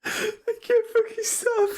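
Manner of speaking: high, helpless laugh-crying, can't stop; laughing so hard the words turn to tears; crying with laughter. Real, not acted. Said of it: "I'm crying".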